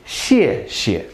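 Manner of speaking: usual speaking voice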